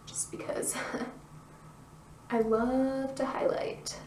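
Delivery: giggly slow whisper. Real, not acted. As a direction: whisper it through a little laugh.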